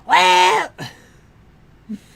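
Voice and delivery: high raspy hag voice; squeaky witch drawl; crone voice. Pretend. Same put-on voice continues.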